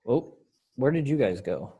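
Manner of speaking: ordinary delivery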